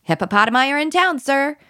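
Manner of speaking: high silly answer, like a little soldier; playful code answer. Shown as a demonstration. Child answering the parent's code word, playful and high. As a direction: answer the password with mock salute. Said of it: "It could be".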